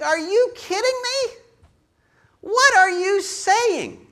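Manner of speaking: very high, loud disbelief, slow on "What are you saying"; incredulous. Retold or imitated. Preacher reacting to the control excuse, very high and incredulous. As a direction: squeak out your disbelief. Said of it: "I think".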